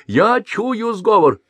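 high, loud slow accusing cry; shout. Acted. Merchant in bast shoes shouting an accusation. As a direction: cry out the plot. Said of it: "закричал".

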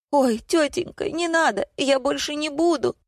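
very high, pleading squeal; high-pitched acted yelp; squealing teenager. A narrator's reading. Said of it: "Взвизгнул подросток".

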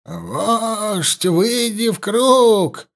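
high, slow, quavering call to the chief; a trembling old shaman summons the chief; loud shaky shaman voice. A narrator's reading. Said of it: "громко, но дрожащим голосом потребовал он". (громкий, но дрожащий голос шамана)

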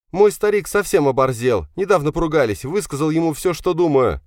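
put-on high-pitched resentful young man; aggrieved son. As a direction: complain hotly about father, voice high and loud. Son complains about father by phone. (обиженный сын, высокий голос)

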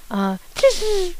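long piercing high tss hiss; a drawn-out, very high tss to call the dog; shrill tss. A narrator's reading. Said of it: "prolongé, suraigu".

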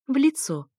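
high-pitched, pointed correction; correcting him. A narrator's reading. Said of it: "поправила я".

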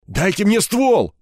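slow, high strained rasp; hoarse demand. A narrator's reading. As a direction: rasp out the demand, stretching it with rage. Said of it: "прохрипел он". (злобно прохрипеть, высоко и протяжно)

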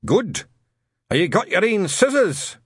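put-on loud, barking old Scot; gruff old sergeant. Shadwell questioning Newton, gruff and loud. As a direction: bark the question.

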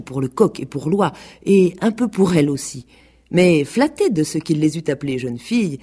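plain reading voice

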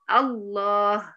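long, slow الله starting on a clear "a": Allaah; open initial hamza. Shown as a demonstration. Teacher starting speech on this word, الله with open hamza, slowly drawn out. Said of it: "هتبقى مفتوحة".

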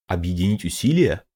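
puzzled echo of the phrase; astonished. A narrator's reading. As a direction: repeat it in disbelief. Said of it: "изумлённо встопорщил усы Янь Ван".

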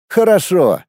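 understated resigned sigh of a word. A narrator's reading. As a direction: give in reluctantly. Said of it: "обречённо вздохнул он".

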